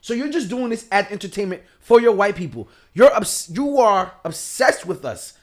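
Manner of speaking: loud, high-pitched exasperated rant; real indignation, pushed up high; worked-up rant. Real, not acted. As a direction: get heated, voice climbing high and loud. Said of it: "You sound like [name] right now".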